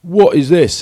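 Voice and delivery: high, loud, drawn-out teasing whoa; exaggerated high yelping disbelief; mock astonished shout. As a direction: shout it out like a ribbing teammate spotting something flashy. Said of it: "And he went".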